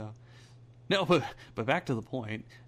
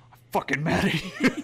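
higher, louder mock-mad outburst; mock angry; 5 semitones higher, 8 dB louder than usual. Giggling over the idea of angry sex, mock angry. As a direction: play at being furious, laugh close behind. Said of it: "Angry sex".

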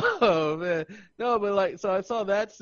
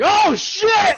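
higher, loud laughing yell; mock shout; 10 dB louder than usual. Imagining spotting the kid, loud laughing yell. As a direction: yelp it in shock, laughing. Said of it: "I would be like".

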